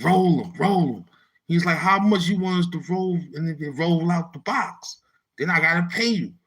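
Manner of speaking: heated retort. Retold or imitated. Heated dice argument.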